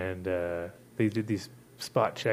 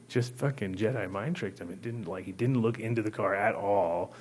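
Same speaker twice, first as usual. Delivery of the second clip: ordinary talk, nothing marked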